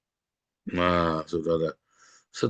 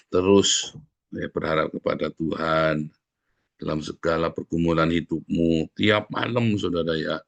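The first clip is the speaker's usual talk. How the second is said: normal talking voice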